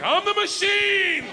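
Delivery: very high, drawn-out mock battle cry; triumphant shout. Student declares himself to laughing gangster, triumphant shout. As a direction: bellow it slowly like a proud catchphrase. Proudly repeats catchphrase to gangster.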